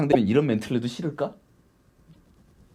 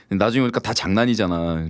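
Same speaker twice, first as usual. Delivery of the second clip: ordinary talk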